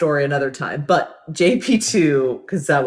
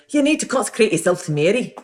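higher-pitched Scottish priest; Glasgow accent; 6 semitones higher than usual. Retold or imitated. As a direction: urge it in a put-on Scottish accent. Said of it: "that's my Glasgow, uh, accent".

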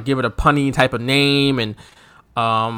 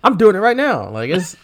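talking mid-dance, moving off the mic; wandering pitch; 8 semitones higher than usual. Real, not acted. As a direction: voice bobbing while dancing. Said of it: "your voice is fluctuating".

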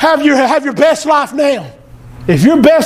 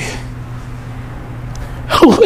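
quiet unvoiced chuckle; soft breathy laugh; 6 dB quieter than usual. Real, not acted. Preacher pleased by his own point, soft laugh. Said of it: "I like that part a lot".

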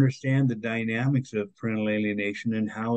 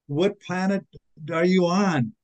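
higher-pitched, exasperated disbelief; incredulous retort; 6 semitones higher than usual. Retold or imitated. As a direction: retell it as an incredulous comeback, pitched up. Retort to the judge's lenient remark.

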